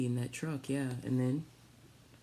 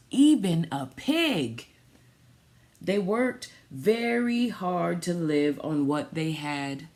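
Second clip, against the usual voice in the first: storybook reading; 5 dB louder, slower than usual. Acted. Reads picture book aloud.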